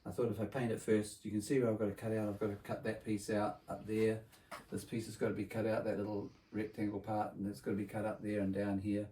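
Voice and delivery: normal talking voice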